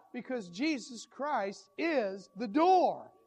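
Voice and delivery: preacher to congregation, a loud slow declaration; emphatic pulpit declaration. Acted. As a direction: drive the point home. Preacher's climactic statement.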